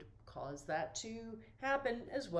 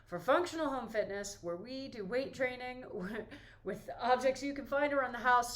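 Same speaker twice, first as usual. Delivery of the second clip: echoey room; 5 semitones higher, 6 dB louder than usual. Real, not acted. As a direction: voice bouncing off bare walls. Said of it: "it's so echoey in here".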